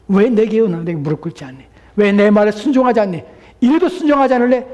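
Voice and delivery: high, loud demanding questions; stern rebuke. Acted. God confronting disobedient Pharaoh, loud stern rebuke. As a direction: press each question harder, building in force.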